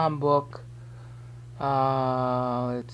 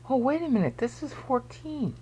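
mock surprised discovery, higher pitch; imagined reader's higher-pitched double take; sudden realization; 8 semitones higher, 3 dB quieter than usual. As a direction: act out someone just noticing, voice lifted high. Voices someone suddenly noticing the pattern.

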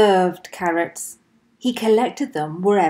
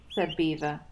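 ordinary delivery, nothing marked

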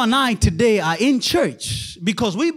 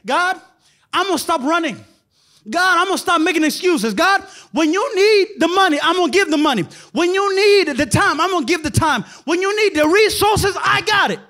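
eager pledge; 7 semitones higher than usual. Retold or imitated. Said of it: "who is saying".

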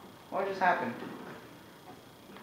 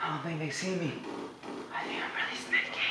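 sneaky whisper; 11 dB quieter than usual. Acted. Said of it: "so I can be really sneaky".

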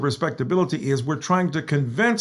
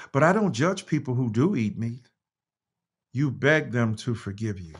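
reading aloud; 5 semitones higher than usual. Acted. Reads his written piece aloud.

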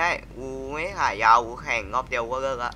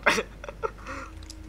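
breathy laugh; 11 semitones higher, 6 dB quieter than usual. Real, not acted. Laughs after being punched in game.

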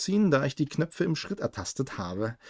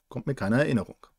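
plain reading voice

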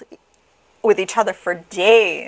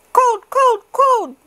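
put-on high, insistent baby voices; shivering babies; 5 dB louder, slower than usual. A narrator's reading. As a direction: complain of cold in a tiny high voice. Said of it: "said the shivering babies".

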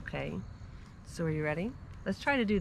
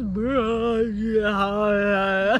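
mock moaning mummy; mummy groan; much slower than usual. Mom voices a mummy, mock moaning. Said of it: "The mummy in the house goes".

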